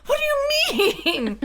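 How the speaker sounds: very high, drawn-out mock wail; frustrated outburst. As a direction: wail it in comic exasperation. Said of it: "I just got so frustrated".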